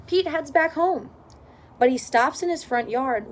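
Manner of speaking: plain reading voice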